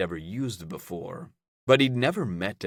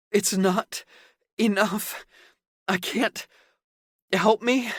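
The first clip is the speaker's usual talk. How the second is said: high, desperate, breathy begging; pleading whimper; 14 semitones higher, 4 dB louder than usual. A narrator's reading. Caden begging during intimacy.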